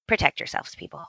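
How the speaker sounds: hushed mock warning; half-joking whisper to the audience. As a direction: breathe it out like a secret warning.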